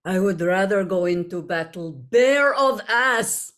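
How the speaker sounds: slow, measured start swelling to a loud punchline; game character's line. Acted. Actor performing her character's retort for fans, dramatic and drawn out. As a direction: deliver it slowly, land the last words big. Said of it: "And I say to them".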